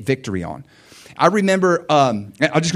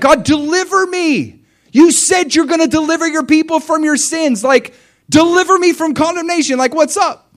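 much higher, loud pleading cry; desperate prayer; 9 dB louder than usual. Retold or imitated. Preacher reliving his desperate cry to God. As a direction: beg God at the top of your voice.

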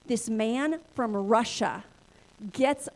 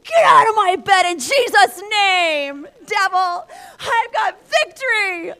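loud, slow, tearful commanding shout; crying rebuke in Jesus' name; rebuking shout; 8 semitones higher, 13 dB louder, slower than usual. Retold or imitated. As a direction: cast it out with full authority. Rebuking snake as devil.

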